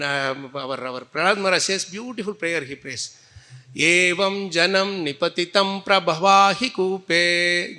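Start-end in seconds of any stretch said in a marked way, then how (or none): 3.8-7.8 slow, even Sanskrit recitation at normal pace; teacher reciting a shloka, then chanting it slowly for students; recited verse. Shown as a demonstration. Recited whole. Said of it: "please try to repeat this shloka".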